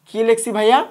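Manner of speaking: normal talking voice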